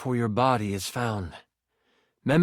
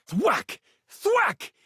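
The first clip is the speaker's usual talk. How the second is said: punchy, high-pitched "thwack"; voiced impact sound; 13 semitones higher than usual. A narrator's reading. Narrator sounding out hits on the king, punchy. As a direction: spit out each hit like a blow.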